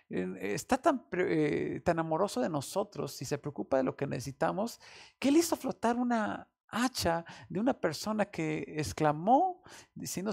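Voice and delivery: ordinary talk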